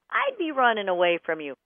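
ordinary talk, nothing marked

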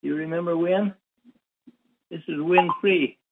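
ordinary talk

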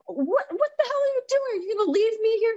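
high, incredulous cry at her husband; shrill disbelief, how dare he walk out; indignant outburst. Retold or imitated.

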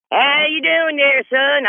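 high Southern greeting; country accent. Retold or imitated. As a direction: ham up a folksy old neighbor. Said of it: "this how we talk like up in here".

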